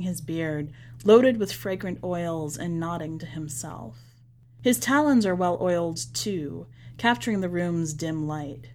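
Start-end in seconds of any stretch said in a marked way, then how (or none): none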